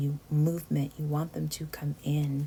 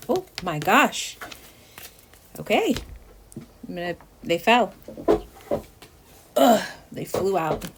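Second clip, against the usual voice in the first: louder, high yelp of surprise; startled exclamation; 3 semitones higher, 8 dB louder than usual. Real, not acted. Reader startled as cards fly from the deck, real surprise. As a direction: react as cards spill out.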